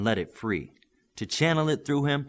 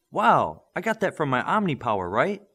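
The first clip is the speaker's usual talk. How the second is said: excited; 6 semitones higher, 6 dB louder than usual. Acted. Excited boy reacting to scores.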